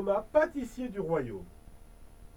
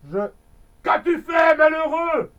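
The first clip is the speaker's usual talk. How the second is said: loud, higher despairing shout; desperate cry; 5 semitones higher, 8 dB louder than usual. Acted. Pastry chef scolding the elf, despairing shout. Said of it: "s'écria le pâtissier désespéré".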